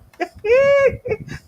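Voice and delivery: big high laugh bursting out; loud burst of laughter. Real, not acted. Host laughs out loud at a viewer's comment.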